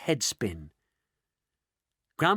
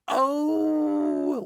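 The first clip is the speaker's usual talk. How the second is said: high, drawn-out mock wail; 12 semitones higher than usual. A narrator's reading. Grandma Georgina lamenting, a high hammed-up wail. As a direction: moan in mild despair. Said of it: "wailed Grandma Georgina".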